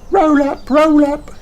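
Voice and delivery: very high fairground call; high singsong hawker cry; Emily Elephant calling. A narrator's reading. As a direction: call out like a tiny stallholder. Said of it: "she calls".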